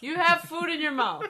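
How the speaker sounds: high, loud laughing outburst. Real, not acted. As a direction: squeal it through laughter. Laughing at friend eating.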